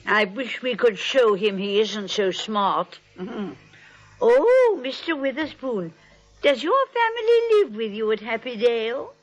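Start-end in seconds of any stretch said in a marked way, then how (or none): 4.2-4.8 bright idea. Acted. Aunt suddenly struck by an idea.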